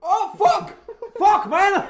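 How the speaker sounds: loud, high laughing cry; shocked laughing shout. Real, not acted. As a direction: yell it through laughter. Secoué par la machine. (cri de surprise en riant)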